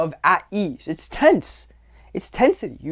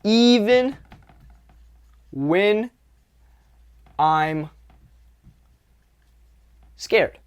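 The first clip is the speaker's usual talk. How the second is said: "Even when I'm scared" with a long pause after each word; emphatic word-by-word; 4 semitones higher, much slower than usual. Shown as a demonstration. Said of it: "Hear this".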